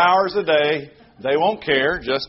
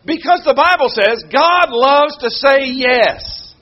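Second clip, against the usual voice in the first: preaching; 6 semitones higher, 5 dB louder than usual. Acted. Preaching with rising force.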